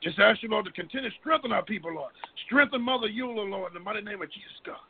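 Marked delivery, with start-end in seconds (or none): none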